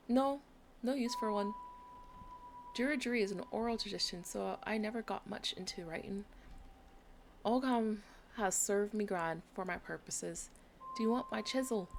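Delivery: mild Irish lilt, a touch gruff; easygoing old Irishman; gently Irish, shrugging off the question; light Irish accent. Acted. Voices Irish NPC.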